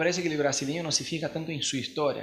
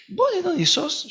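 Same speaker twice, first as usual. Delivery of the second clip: quoting the cab driver; slower than usual. Retold or imitated. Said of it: "El chabón me dijo".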